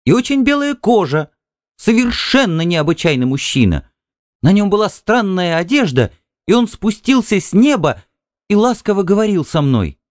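высоко и оживлённо, с восхищением; рассказать о чуде, не сдерживая восторга; восторженно. A narrator's reading. Жена восторженно описывает мужчину из сна. (жена восхищённо описывает мужу гостя из сна)

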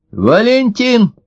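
high, drawn-out call to a husband; wife's long high-pitched hail. A narrator's reading. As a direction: call the name down from upstairs. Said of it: "крикнула к отцу жена с второго этажа".

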